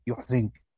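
يحزنك with damma on ya, kasra on zay; Nafi's reading. Shown as a demonstration. Teacher repeating the variant after its rule, يحزنك as "yuḥzink". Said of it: "بضم الياء وكسر الزاي".

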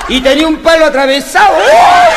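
shrill high loud yelps; hammed-up camp squeal; loud high camp shriek; camp mimicry. Mocking effeminate guest.

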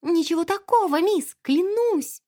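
high pleading maid, drawn out at the end; desperate oath. A narrator's reading. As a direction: swear innocence anxiously. Frightened maid swears her innocence. (отчаянная клятва высоким голосом)

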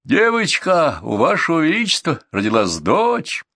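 high-pitched joyful announcement; elated messenger calling out high; excited shouting. A narrator's reading. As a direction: cry out the happy news. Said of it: "закричали гонцы".

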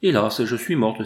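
ordinary delivery, nothing marked

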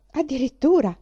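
loud, high mock astonishment; amazed exclamation. Henry amazed at Catherine's taste, loud. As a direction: throw up the word in surprise.

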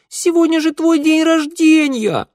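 put-on high, ringing, offended child; hurt little boy. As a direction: whine it out like a wounded kid. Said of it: "голос мальчика зазвенел от обиды".

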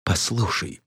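hushed, tentative appeal; meek, half-whispered request; timid whisper. A narrator's reading. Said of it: "робко прошептала графиня".

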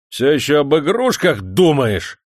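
громко и медленно, с надрывом; рявкнуть на крепостного, растягивая слова; орёт. Acted. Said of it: "заорал он на крепостного". (помещик орёт на Шорника, громко и медленно)

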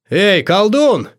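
high, drawn-out taunting yell; loud call-out, pitch climbing high; yelling challenge. A narrator's reading. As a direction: holler for the coward to come out. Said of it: "заорал Амадей".

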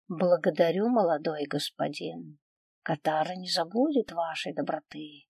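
softly voiced, humble thanks; grateful servant girl. A narrator's reading. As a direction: thank the master meekly and quietly. Grateful slave girl thanks young master.

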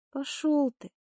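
high hissed snap. A narrator's reading. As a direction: hiss it at him sharply. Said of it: "шикнула я".

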